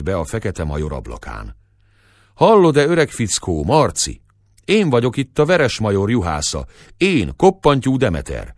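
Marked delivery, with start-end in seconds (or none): 2.3-8.5 loud call at the window, pitched up; shouting to the house from outside. Acted. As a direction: hail the rival through the glass. Shepherd calling at the window.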